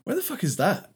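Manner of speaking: high-pitched puzzled reaction; mock bafflement. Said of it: "I'm like".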